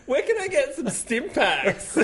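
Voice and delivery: high, slow squeaky goofy voice; silly high voice. Pretend. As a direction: ask it like a cartoon critter. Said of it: "You can say anything in that voice".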